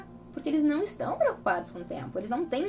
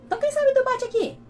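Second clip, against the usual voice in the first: put-on much higher, louder sing-song offer to an angry child; coaxing the child; 14 semitones higher, 5 dB louder than usual. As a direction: lift it up high, like cheering a small kid on. Mãe oferece alternativa à raiva. (voz bem aguda e mais alta, persuadindo a criança)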